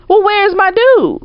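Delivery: high, drawn-out imitated demand; indignant demand. Friend asking after her man, indignant. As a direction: pitch it way up, stretching words.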